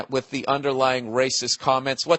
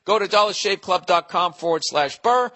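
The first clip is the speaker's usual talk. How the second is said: upbeat ad read, a bit higher; 4 semitones higher than usual. Acted. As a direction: sell it like a radio spot. Reads the sponsor ad.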